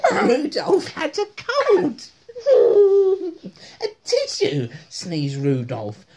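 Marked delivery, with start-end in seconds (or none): 0.1-3.5 loud, high, cracking up mid-read; giggling fit while reading; laughing hard. Real, not acted. 3.8-4.7 loud, high comic plea; sneezy character's high call; high sneezy squeak. Acted.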